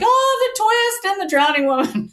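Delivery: gushing fan. Retold or imitated. Mocking gushing social media fans.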